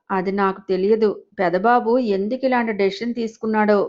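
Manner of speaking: ordinary delivery, nothing marked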